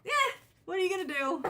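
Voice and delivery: high, loud voice breaking between laughter and crying; voicing the ancestors while half laughing, half crying; laughing through tears. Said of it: "all my ancestors saying".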